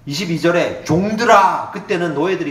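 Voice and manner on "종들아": louder, declaiming Bible reading; solemn loud scripture read. Acted. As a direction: proclaim the verses to the congregation. Reads scripture aloud.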